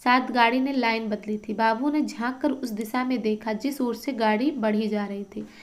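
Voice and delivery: normal talking voice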